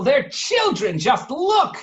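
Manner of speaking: very high, loud outraged cry; furious shout. Acted. Waffle yells at Biscotti, very high and loud. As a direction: yell it in outrage. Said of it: "screamed Waffle".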